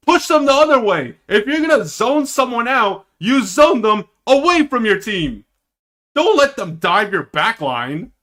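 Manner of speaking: frustrated backseat coach yelling at the screen; yelling instructions. Real, not acted. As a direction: bark orders at the players. Yelling advice at players on screen.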